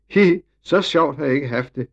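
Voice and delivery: a hint of a child's high, loud voice; gleeful little girl. A narrator's reading. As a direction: lightly play a delighted small girl. Little Ida gleeful after coming down.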